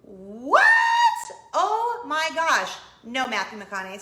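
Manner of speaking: loud, disbelieving gasp at the price; stunned outcry. Retold or imitated. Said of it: "and I'm like".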